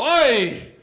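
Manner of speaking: loud, high, wailing oi; mock shocked oi. Guys at the mitzvah tank, a loud wailing oi. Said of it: "they go".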